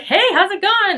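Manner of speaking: chirpy hello to a New York shop clerk; bubbly greeting. Retold or imitated. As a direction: greet a stranger with cheer. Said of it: "I was like".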